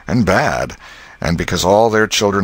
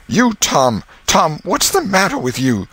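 gasped out; 11 semitones higher than usual. Acted. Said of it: "she gasped out".

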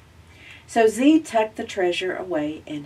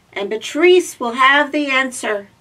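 higher, loud eager robot; little robot voice; 7 semitones higher, 10 dB louder than usual. Acted. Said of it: "explained Z".

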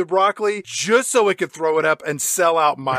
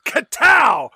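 mock battle shout; 8 semitones higher, 6 dB louder than usual. Mock swing at a head.

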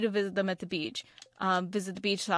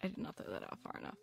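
whispered; 14 semitones lower, 15 dB quieter, faster than usual. Real, not acted. Botched fishing cast.

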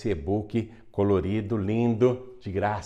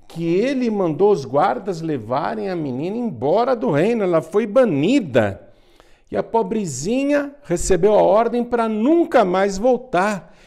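dramatic telling; 11 semitones higher, 7 dB louder than usual. A narrator's reading. Dramatic banishment narration.

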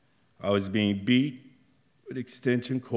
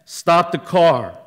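screaming; 8 semitones higher, 9 dB louder, slower than usual. Retold or imitated. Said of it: "I'm screaming".

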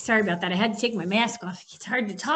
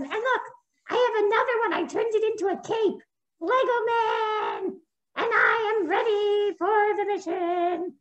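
high, cheery toy superhero voice; a kid-friendly puppet hero, pitched up; high, proud puppet hero; Lego Man puppet voice; 9 semitones higher than usual. Acted.

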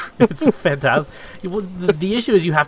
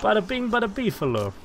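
pitched-up mock Italian New York accent; beefalo accent; 6 semitones higher, 4 dB quieter than usual. Friend imagining how beefalo talk, high mock New York accent. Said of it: "My beefalo accent".

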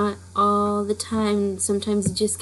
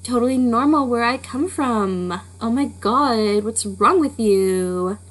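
put-on louder, stretched-out, affronted Valley Girl; hammed-up Valley Girl outrage with swooping pitch; Valley Girl accent; 3 semitones higher, 5 dB louder than usual. Telling critics it's normal back home, exaggerated Valley Girl accent. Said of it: "I don't talk like that".

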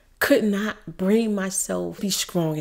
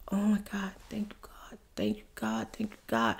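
hushed, whispered prayer of relief; soft reenacted whisper of gratitude; whispered thanks; 8 dB quieter than usual. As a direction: whisper it gratefully under the breath. Retells relieved thanks after being comforted.

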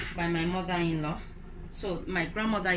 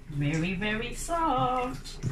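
soft stretched long and higher; drawn-out very soft; 6 semitones higher, much slower than usual. Shown as a demonstration. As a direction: linger on "very, very soft". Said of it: "I like it very".

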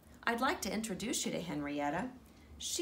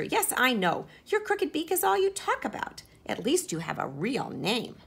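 high, loud fed-up retort; exasperated sister hen; 5 semitones higher, 7 dB louder than usual. Acted. Sister snapping back at Henrietta, high and loud. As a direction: roll your eyes at her complaining.

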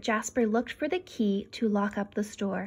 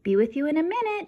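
calling out; 7 semitones higher, 4 dB louder, faster than usual. A narrator's reading. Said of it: "Jasper called".

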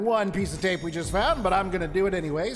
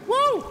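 very high whoop of delight; gleeful whoop; 4 dB louder than usual. Real, not acted. Player enjoying the slide, gleeful whoop. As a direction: let out a thrilled high woo.

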